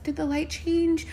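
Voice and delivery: high, slow, innocent question; little old lady. Retold or imitated. Old lady crossing the street asks, slow and high. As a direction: imitate a tiny, oblivious old lady.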